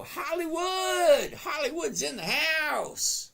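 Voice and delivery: loud, high, long-held shout; big drawn-out welcome yell; hollered shout-out. Real, not acted. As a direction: holler the name like an arena announcer. Hailing a viewer joining the livestream.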